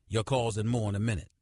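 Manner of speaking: ordinary delivery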